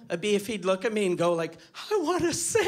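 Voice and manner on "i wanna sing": high-pitched imitation of a deaf child; imitated deaf little son announcing a wish; mock deaf child. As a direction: put on a deaf kid's high voice. Imagined deaf son voices his wish.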